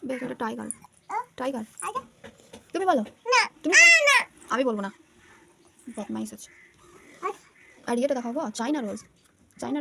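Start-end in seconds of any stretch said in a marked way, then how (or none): 2.7-4.9 rising to a loud, high yell; exasperated yell. Real, not acted. Mother yelling at her son to answer the word. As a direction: demand the answer louder and louder. Parent coaxing child to answer.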